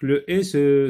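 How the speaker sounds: ordinary delivery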